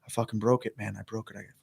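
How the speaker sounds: low, soft run of inner thoughts; quiet despairing self-talk. Retold or imitated. Man replaying his panic to himself, quiet and defeated. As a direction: let it drop almost under your breath.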